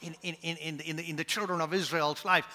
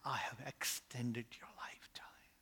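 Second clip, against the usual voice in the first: soft, secret whisper; 6 semitones lower, 14 dB quieter than usual. Acted. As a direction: breathe the words like a revelation. Heaven's message, hushed.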